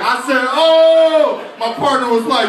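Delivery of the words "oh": high, loud yelp of shock; loud pained "oh". Acted. Teacher bitten by little boy, loud shocked yelp. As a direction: cry out as if suddenly bitten. Acted cry on being bitten.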